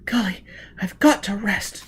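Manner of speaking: gasping, exhausted; tired and winded; out of breath. A narrator's reading.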